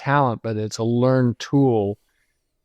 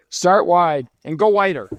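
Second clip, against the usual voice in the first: mock director's yell; 6 semitones higher than usual. Imitating a director yelling at his cameraman, mock yell. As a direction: bark it like an impatient director, half-hearted. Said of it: "director that would yell at his cameraman".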